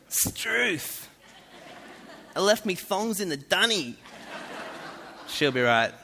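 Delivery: high, hammed-up broad Aussie bogan; bogan Australian. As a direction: exaggerate the bogan Australian twang. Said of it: "they're so bogan".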